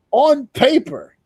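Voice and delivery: stressing it loud and slow; emphatic. Real, not acted. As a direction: hammer the point home. Emphatic point about favorite.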